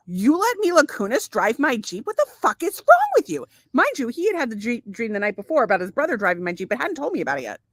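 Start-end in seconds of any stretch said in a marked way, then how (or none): 0.1-3.5 upset retold outburst. Confronting husband over the Jeep in a dream, upset outburst. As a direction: retell it as an incredulous, high-pitched blow-up. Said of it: "I was really upset".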